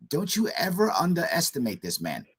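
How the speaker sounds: normal talking voice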